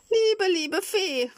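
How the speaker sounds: very high, slow, drawn-out plea; desperate pleading. Acted. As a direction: beg like a frightened child. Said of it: "flehte Pinocchio". (flehend, hoch und gedehnt)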